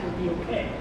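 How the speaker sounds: ordinary delivery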